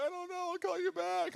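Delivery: high wailing sob-voice; mock crying. Pastor imitating himself crying on the phone to his girlfriend. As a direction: wail it out through tears, high-pitched. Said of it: "So I'm, like, crying".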